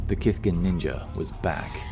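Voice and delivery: ordinary delivery, nothing marked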